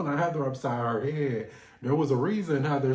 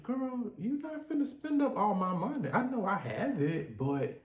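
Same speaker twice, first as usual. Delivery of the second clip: imagined Todd; 7 semitones higher than usual. Retold or imitated. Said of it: "I'm sure Todd is like".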